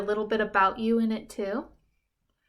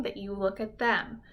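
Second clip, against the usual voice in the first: normal talking voice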